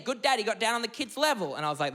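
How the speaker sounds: ordinary delivery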